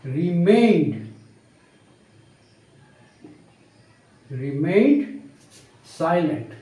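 drawn out word by word with long pauses; slow dictation. Shown as a demonstration. Teacher dictating the new sentence, slow and spaced. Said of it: "আমরা বলি এরকমভাবে".

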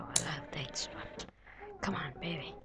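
hushed pleading to the game; whispered urging. Real, not acted. Player whispering to the character during a tense jump, hushed. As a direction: whisper it like you're holding your breath.

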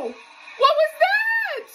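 very high, slow, shouted disbelief; can't believe what she just saw; stunned shout. Real, not acted. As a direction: yell it in amazed shock. Stunned by a stunt.

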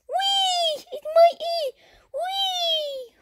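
very high, playful cheering squeal; gleeful squealing. Pretend. Bird celebrating its own egg, gleeful squealing. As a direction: squeal with glee like a little cartoon bird.

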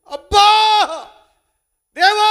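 high, loud cry of pain; high, loud howl, calling on God; pained yell. Pretend. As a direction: cry out as the stone lands. Imagined listener hit, crying out.

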